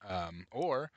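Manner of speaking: usual speaking voice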